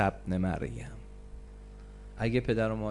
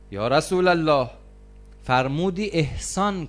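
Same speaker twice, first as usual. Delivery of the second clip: raised invocation; 5 semitones higher, 5 dB louder than usual. Acted. Preacher invokes the Prophet.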